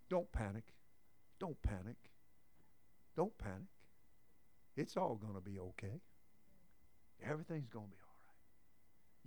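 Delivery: very soft, reassuring hush; calm a frightened crowd gently; soothing calm. Real, not acted. Preacher soothing congregation.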